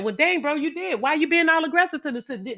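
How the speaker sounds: high-pitched mock disbelief; incredulous protest. Chat member asking why he's so aggressive, incredulous. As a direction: call him out in a high voice.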